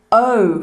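"O" drawn out slowly as "oh"; slow, rounded "O"; long O sound. Shown as a demonstration. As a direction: stretch "O" into a slow long vowel. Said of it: "We don't say the".

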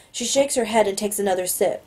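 usual speaking voice

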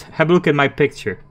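ordinary talk, nothing marked

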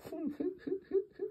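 high breathy snickering laugh. Shown as a demonstration. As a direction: snicker quietly.

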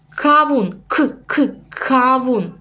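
"к" of "кавун" tapped twice alone, then "кавун" whole; stress the first sound. Shown as a demonstration. Teacher to parents, showing how to stress the first sound. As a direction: pick out the opening "к" of "кавун" before the word. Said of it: "Ми даємо такий наголос, да, на перший звук".

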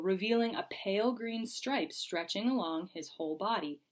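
ordinary delivery

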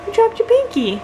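put-on high baby talk. Mom talking to baby.

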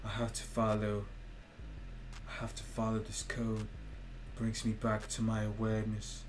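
mock-serious English accent. Streamer mocking his over-serious self in an English accent. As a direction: put on a careful, solemn English accent. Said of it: "English accent".

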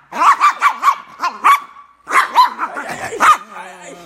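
high whispered yelps of pain; pained cries. Real, not acted. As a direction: gasp out the pain. Bitten again.

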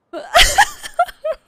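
high, loud cackling laugh; helpless laughter. Real, not acted. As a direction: crack up completely. Laughing fit at game glitch.

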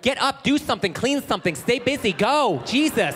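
hammed-up nagging boss; bossy nagging. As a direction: bark orders in rapid bursts, exasperated at the end. Nagging wife as restaurant manager.

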